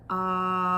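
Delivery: long sustained loud ahh; held ah sound. Shown as a demonstration. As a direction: hold a steady ah for breath support. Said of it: "we do an ah sound and we hold".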